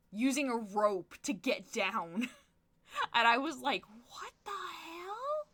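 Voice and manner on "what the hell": breathy, disbelieving whisper of shock; stunned whisper. Retold or imitated. Retelling the store robbery to a friend, stunned whisper. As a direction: whisper it like you can't believe your eyes.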